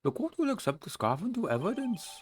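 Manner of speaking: Judge voice. Acted. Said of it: "like, the Judge, like, Jowly voice into it".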